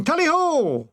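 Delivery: shouted. A narrator's reading. Soldiers turning in after wine, a high tally-ho cry. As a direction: call it out like a huntsman. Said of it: "we went to sleep shouting".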